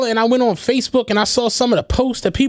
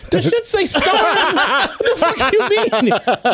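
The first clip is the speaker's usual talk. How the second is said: high, laughing disbelief; retelling his shock at the credits, laughing; 6 semitones higher, slower than usual. Retold or imitated. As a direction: laugh through the protest at the credits.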